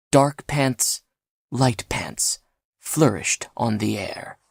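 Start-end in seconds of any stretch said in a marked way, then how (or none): none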